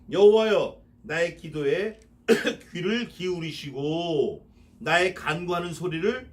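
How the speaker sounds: loud call, then slow reading; slow solemn invocation; fervent verse reading. Acted. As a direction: call out to the Lord, slowly.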